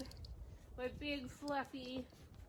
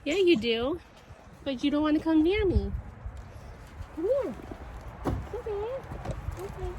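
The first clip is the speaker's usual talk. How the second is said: pet-talk voice; 5 semitones higher, 5 dB louder than usual. Real, not acted. Calling cats in high pet voice.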